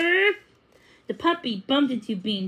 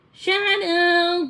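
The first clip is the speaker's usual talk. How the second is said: calling; 7 semitones higher, 3 dB louder than usual. A narrator's reading. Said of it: "Lizzie called".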